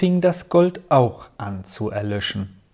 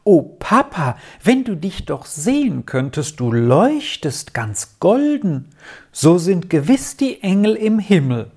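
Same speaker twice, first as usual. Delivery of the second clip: put-on bright, higher child's exclamation in awe; overwhelmed cry; 5 semitones higher than usual. A narrator's reading. Girl to her father, overwhelmed by the sunset glow. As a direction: gasp it out, amazed by the golden light. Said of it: "rief sie auf einmal überwältigt".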